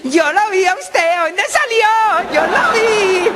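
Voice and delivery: aguda y lenta, niño burlón; voz de niño chantajista, muy aguda; voz de niño pícaro. Acted. (voz aguda y lenta de niño pícaro)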